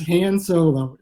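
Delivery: "Han" stretched with a short A, as in "hand"; slow "Han Solo" with a Midwestern A; Great Lakes pronunciation. Shown as a demonstration. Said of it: "If you grew up around the Great Lakes".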